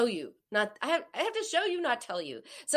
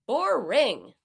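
louder sighing dismissal; exaggerated sigh of boredom; 3 semitones higher, 6 dB louder than usual. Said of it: "mock bored".

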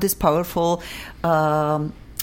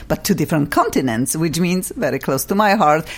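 ordinary delivery

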